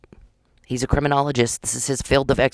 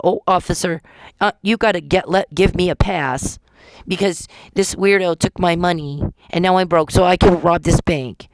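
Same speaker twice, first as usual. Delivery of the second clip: mock whiny plea; 5 semitones higher, 4 dB louder than usual. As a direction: ham up a pleading victim begging the cop. Mock plea to police officer.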